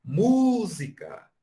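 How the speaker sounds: "Música" called high, loud and slow on "mu"; called-out stress. Shown as a demonstration. Teacher showing the strong syllable by calling out. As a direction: shout "Música" up the hill, holding "mu". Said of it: "a própria palavra música".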